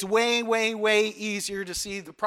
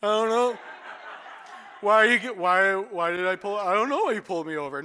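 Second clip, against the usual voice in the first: playing stupid. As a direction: act dumb with a nervous laugh. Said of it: "play stupid".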